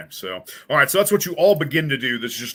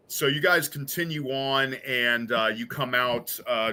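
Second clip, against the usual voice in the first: normal talking voice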